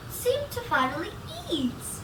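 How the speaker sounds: ordinary delivery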